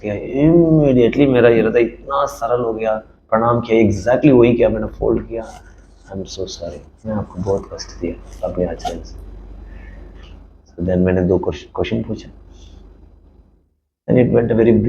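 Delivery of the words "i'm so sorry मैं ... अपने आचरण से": low, very soft contrite apology; barely audible, humbled; retold apology said low and soft; hushed apology.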